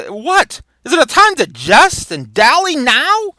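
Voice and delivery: high, loud incredulous rebuke; exasperated stage outburst; acted outrage. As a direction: scold the joker in theatrical disbelief. Prince outraged at Falstaff's joke.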